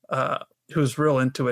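ordinary talk, nothing marked

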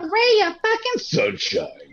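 very high, slow sing-song "ray of sunshine"; sarcastic falsetto sunshine; mock sunny chirp. Mocking the mug slogan.

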